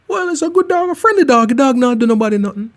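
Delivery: high squeaky defence of a good dog; imitating caller.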